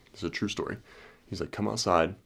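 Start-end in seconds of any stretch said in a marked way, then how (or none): none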